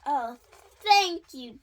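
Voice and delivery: loud, grateful outburst; delighted relief. A narrator's reading. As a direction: thank the doctor with big relief. Elephant relieved by diagnosis.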